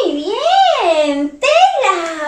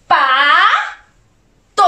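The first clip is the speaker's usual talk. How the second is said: "Pato" split, Pa then to; syllable by syllable. Shown as a demonstration. Teacher asking children what it says, syllable by syllable. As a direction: sound out "Pato" with a pause between Pa and to. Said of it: "Junto, ¿qué dice?".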